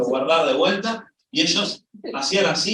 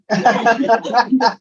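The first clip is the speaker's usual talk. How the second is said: short loud burst of laughter; real laugh at the joke; 6 semitones higher, 5 dB louder than usual. Real, not acted. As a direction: laugh at the absurd question. Laughs at own punchline.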